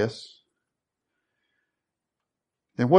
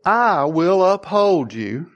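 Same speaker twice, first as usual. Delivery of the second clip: slow, raised-pitch scripture promise; solemn proclamation; 5 semitones higher, 3 dB louder, slower than usual. Acted. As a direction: proclaim it slowly, as God's own promise. Voicing God's promise from scripture.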